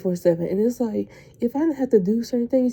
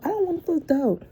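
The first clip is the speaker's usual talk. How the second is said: exasperated outburst; 9 semitones higher than usual. Real, not acted. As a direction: pitch jumps way higher on the first say. Fed up with pestering coworkers.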